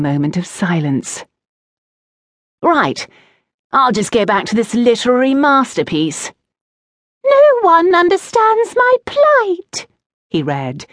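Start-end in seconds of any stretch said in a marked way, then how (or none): 2.6-6.3 high-pitched, sarcastic relish; lightly voiced taunting boy. A narrator's reading. Oakenrot resumes mocking the poem. 7.2-9.9 slow, loud mock-tragic squeal; drawn-out falsetto lament. A narrator's reading. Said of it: "his voice squealing even higher".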